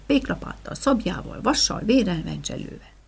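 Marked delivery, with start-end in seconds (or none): none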